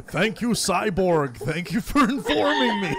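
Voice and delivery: slow, high mock-robotic thank-you, breaking into laughter. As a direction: thank the screen like a machine, deadpan then giggling. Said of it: "You're just like".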